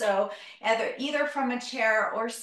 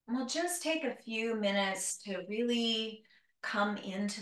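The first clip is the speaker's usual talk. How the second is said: guided meditation; 2 semitones lower than usual. Acted. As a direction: ease them into stillness, gently.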